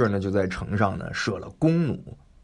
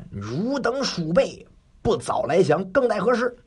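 scolding; 10 semitones higher than usual. Acted. 关羽立马城下骂守军，高声斥责. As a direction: berate the rats from horseback. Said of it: "指着就骂他们".

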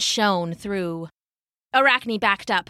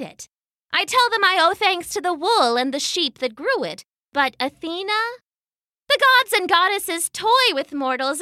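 put-on defiant young woman; 7 semitones higher than usual. Arachne defies disguised Athena.

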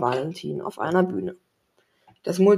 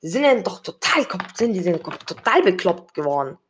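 angry; 4 dB louder than usual. A narrator's reading. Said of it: "rief Tom wütend".

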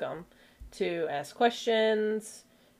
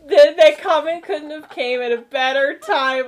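ordinary delivery

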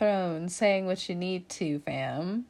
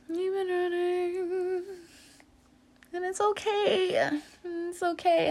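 higher, slow soothing voice; 10 semitones higher, slower than usual. Acted. Reader comforting Libra in a high sing-song voice. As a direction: coo the comfort gently.

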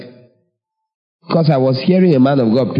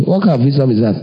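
usual speaking voice

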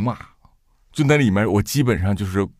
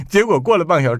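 ordinary talk

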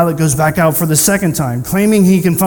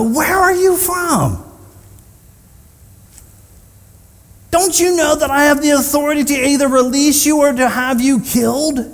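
exasperated interrogator; 8 semitones higher than usual. Acted. Pilate interrogating Jesus in private, high-pitched and exasperated. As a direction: pull rank on a silent prisoner.

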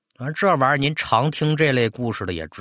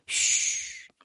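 soft, drawn "shh"; shushing; 8 dB quieter than usual. Acted. Grandmother hushes grandson in the yard, soft shush. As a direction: hush the child softly.

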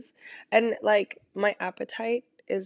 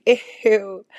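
mock disgust; 7 dB louder than usual. Real, not acted. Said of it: "that sounded so bad".

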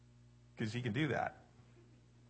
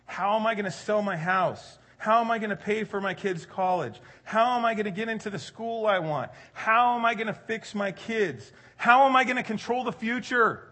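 worried questions building higher and louder; mock rising panic; 5 semitones higher, 5 dB louder than usual. Pastor imitating the congregation's anxious questions, building. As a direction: let the panic climb each question. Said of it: "And so we say".

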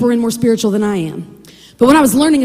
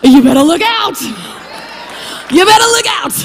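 punchy preacher's shout; shouted warning; 3 semitones higher than usual. Acted. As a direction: holler it as a threat.

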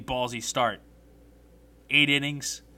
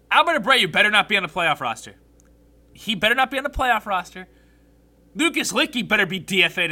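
loud, raised-pitch insistence; angry warning; 5 semitones higher, 6 dB louder than usual. Real, not acted. Fan warning the team about its playoff roster, angry. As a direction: lay down an emphatic warning.

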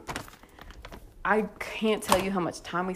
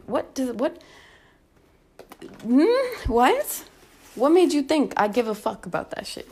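retold indignant retort; 3 semitones higher, 5 dB louder than usual. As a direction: tell him off in exasperation. Acts out indignant retort to man.

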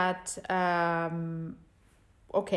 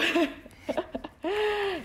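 short high laugh; 12 semitones higher than usual. Real, not acted. Laughing after story.

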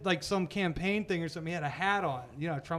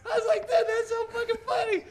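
high, loud words squeezed out through laughter; giggling mid-sentence; laughing through words; 4 dB louder, slower than usual. Real, not acted. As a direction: talk while cracking up. Laughing while retelling.

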